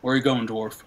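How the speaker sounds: a lightly voiced gruff barman warning the dwarf; a hint of a stern barkeep addressing the dwarf; stern bartender. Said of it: "he very sternly says".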